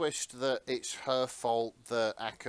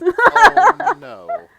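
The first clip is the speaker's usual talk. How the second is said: a high, loud gasped oh; real shock at the plot twist; 21 dB louder than usual. Real, not acted. Listener hearing the villain's plan, shocked high oh.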